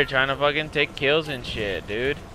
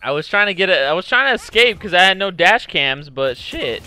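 usual speaking voice

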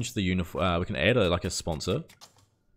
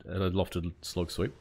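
ordinary talk

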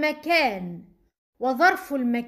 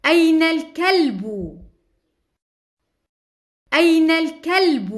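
put-on a louder, clear question to children; loud teacher question; 6 dB louder, faster than usual. Shown as a demonstration. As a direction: call it out to the class. (سؤال بصوت عال للأطفال)

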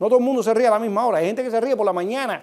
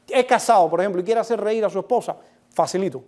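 ordinary delivery

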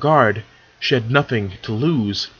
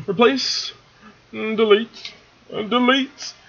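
high singsong; 10 semitones higher, slower than usual. Real, not acted. Muttering through formatting fixes.

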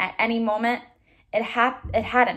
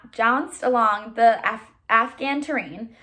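plain reading voice

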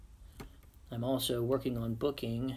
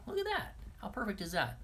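pleased; 9 semitones higher than usual. Real, not acted. Pleased with lighting.

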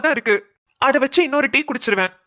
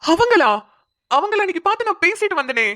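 high-pitched amazed realisation; astonished; 8 semitones higher than usual. Acted. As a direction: blurt it out high in surprise. Learns the bride is her daughter. (ஆச்சரியத்தில் உயர்ந்த குரல்)

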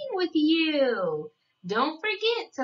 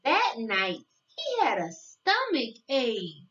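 storybook reading; 3 semitones lower than usual. Acted. Narrator reading a picture book to young children, sing-song storytelling. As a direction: read it like a bedtime picture book, savoring each day.

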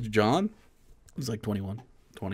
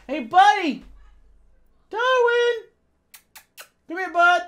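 high, loud, sing-out call to a dog; calling a pet; 4 dB louder than usual. Real, not acted. Owner calls Darwin the dog to come, high calling voice.

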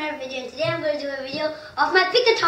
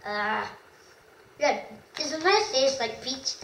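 chewing while talking; talking around soft candy; mouth partly full; 4 dB quieter, slower than usual. Real, not acted. Seen 0: Puts candy in mouth at -1, talks with jaw busy after.

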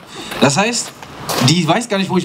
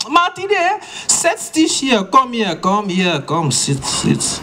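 mother's voice; 6 semitones higher, much slower than usual. Retold or imitated. Mum orders her son to sit down. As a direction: summon him to sit before trouble.

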